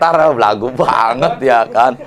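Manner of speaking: ordinary talk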